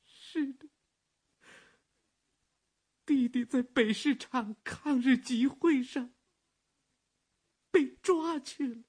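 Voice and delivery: high, strained daughter's voice; grieving daughter. Acted. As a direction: break the bad news haltingly. Daughter delivers bad news. (悲伤的女儿)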